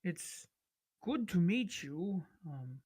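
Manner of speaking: lightly voiced eager scholar, slow and careful; polite scholarly lizard folk. Sarav greets the land people, slow and polite. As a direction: greet the strangers slowly, keen on their culture.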